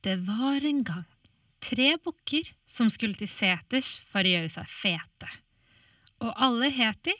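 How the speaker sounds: fairy tale narrator voice; storytelling. A narrator's reading. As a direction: tell it like a bedtime tale.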